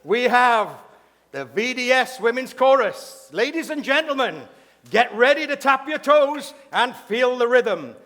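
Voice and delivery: loud, high, projected show introduction; stage announcer. Real, not acted. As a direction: announce the act to the whole hall with big sustained emphasis.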